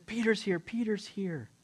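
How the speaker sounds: small high voice, repeating eagerly; little girl announcing. Retold or imitated. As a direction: pipe up with news for the grown-ups. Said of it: "and she says".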